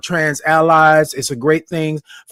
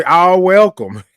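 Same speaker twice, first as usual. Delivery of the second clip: movie-line imitation; 3 dB louder, slower than usual. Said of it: "Y'all remember that movie".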